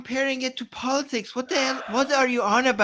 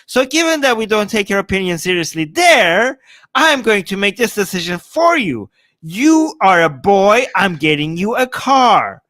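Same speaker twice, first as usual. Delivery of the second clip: mock stern parent; 2 semitones lower, 8 dB louder than usual. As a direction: hammer the decision down like a bossy parent. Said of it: "I'm like".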